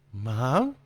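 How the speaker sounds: lightly voiced small boy, unsure; a hint of a scared child; frightened little boy. A narrator's reading. Boy watching his mother's skin ripple, lightly voiced and unsure.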